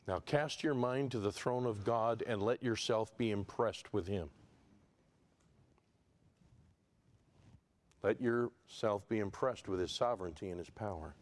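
low, hushed pulpit voice; solemn exhortation. Real, not acted. Preacher urging listeners toward the throne of God, solemn and hushed. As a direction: bring it down low and reverent, leaving space.

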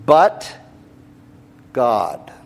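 each word alone, with a long pause between; very deliberate. Real, not acted. Preacher reading the verse's turning point, weighty and deliberate. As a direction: lift "But", pause long, then let "God" fall. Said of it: "very deliberately".

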